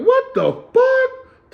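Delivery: very high, loud, stretched-out yell; shouted disbelief. Retold or imitated. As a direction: scream it in disbelief.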